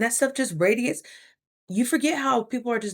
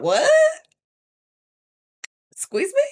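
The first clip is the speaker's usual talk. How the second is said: high, loud mock disbelief; shocked squeak; 7 dB louder, slower than usual. Surprised by a job offer, high loud disbelief. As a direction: jump way up in pitch, stunned.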